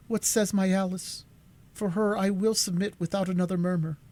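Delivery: lightly voiced resolute Cora. A narrator's reading. Cora asking Alice's answer, lightly voiced resolve. Cora's voice held.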